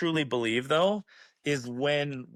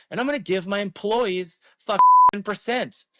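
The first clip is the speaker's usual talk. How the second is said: bold declaration; 7 semitones higher, 8 dB louder than usual. Pretend. Imagined business owner's bold pledge.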